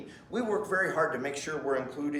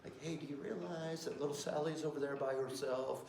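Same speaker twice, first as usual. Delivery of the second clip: much softer, hushed and calm; lowered calm voice; 12 dB quieter than usual. Retold or imitated. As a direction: talk calmly to a child, voice lowered. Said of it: "and I lower my voice".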